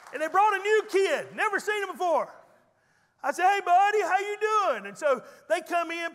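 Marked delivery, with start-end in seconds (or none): 3.5-4.9 much higher and louder friendly call; hearty greeting. Retold or imitated. Pastor greeting a new kid loudly. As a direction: call out warmly across the room.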